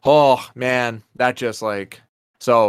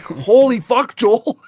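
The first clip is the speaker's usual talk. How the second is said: higher, louder hyped-up yelling; excited shouting; 11 semitones higher, 4 dB louder, slower than usual. Retold or imitated. Friend recounting Joel's rampage in the game, loud excited yelling. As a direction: shout it like you're watching carnage unfold. Reacting to Joel rampage scene.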